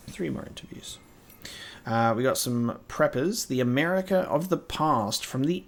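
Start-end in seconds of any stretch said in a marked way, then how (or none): 0.1-1.0 soft whisper to himself. Real, not acted. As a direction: whisper it under the breath. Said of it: "No, three more interviews?".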